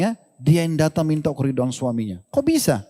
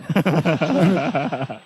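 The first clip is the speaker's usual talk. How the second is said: amused chuckle after the joke; real laugh. Real, not acted. Preacher laughing at his acted marital spat, amused chuckle. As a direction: laugh at your own little skit.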